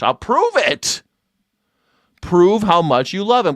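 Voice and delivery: high, pitch leaping on the punch words; goading challenge. Real, not acted. Host daring Trump believers to buy in, high goading challenge. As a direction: dare them, voice shooting up.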